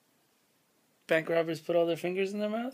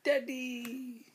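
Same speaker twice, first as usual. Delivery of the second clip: usual speaking voice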